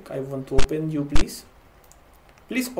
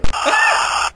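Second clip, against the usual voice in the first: piercing, very high squeal; high-pitched shriek; 4 dB louder than usual. Real, not acted. Player jolted by the game, very high shriek. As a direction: let out a shrill long shriek.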